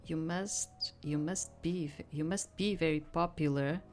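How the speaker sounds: ordinary talk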